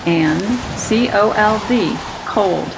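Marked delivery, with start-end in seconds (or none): none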